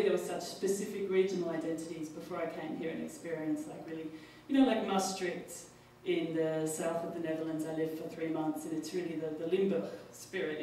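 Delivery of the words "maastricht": ordinary talk, nothing marked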